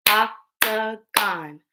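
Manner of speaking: octagon slowly, in three separate beats; octagon split into syllables. Shown as a demonstration. Teacher breaking words into parts with pupils, octagon split in three. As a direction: space out each syllable of octagon. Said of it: "Break words into parts".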